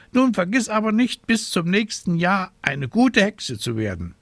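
high, fussy scolding raven voice. A narrator's reading. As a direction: play a worried, scolding raven. Raven reminds her.

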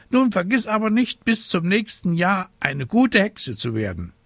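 high-pitched bird lecturing; admonishing raven. Acted. Raven reminding witch of next year, high admonishing.

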